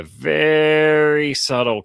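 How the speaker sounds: VERY stretched long, high and loud; "very" sweeps up and lingers before "subtle"; drawn-out emphasis. Shown as a demonstration. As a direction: hold the first vowel of "very" like a long bend. Acting out the curve by stretching.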